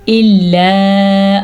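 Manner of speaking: "إِلَّا" with gentle start and long ā; soft hamza. Shown as a demonstration. Teacher showing soft hamza, "إِلَّا" slow and drawn out. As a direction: ease into "إِلَّا", hold its ending. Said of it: "حمزہ کو کتنی نرمی سے پڑھا".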